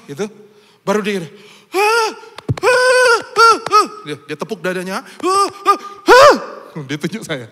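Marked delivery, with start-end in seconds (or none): 1.7-3.9 astonished gasps. Retold or imitated. Mute man amazed by money. 5.0-6.3 astonished gasps. Retold or imitated. Mute man points at himself.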